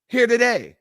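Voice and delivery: ordinary delivery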